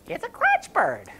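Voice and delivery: very high, slow, proud reveal; gushing wife. Acted. Wife showing her husband his present, gushing. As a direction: draw it out high, presenting a treasure.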